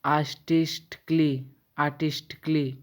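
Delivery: आर्टिस्टिकली said with the a silent; silent a demo. Shown as a demonstration. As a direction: model the word, swallowing the a. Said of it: "ए silent होता है". (आर्टिस्टिकली में ए को silent रखकर बोलिए)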